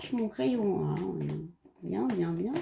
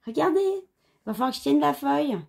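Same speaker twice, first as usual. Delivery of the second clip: excited; 6 semitones higher, 4 dB louder than usual. Real, not acted. Showing annoying coloring book.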